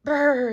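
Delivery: loud shivering brrr; shivering brr. Acted. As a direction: shudder out a loud brrr from the cold. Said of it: "he shivered".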